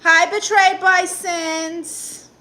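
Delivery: high, loud, slow drawn-out hello; sing-song greeting. Real, not acted. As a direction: call out a big stretched greeting to the chat. Greeting chat viewers on stream.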